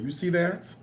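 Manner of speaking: ordinary talk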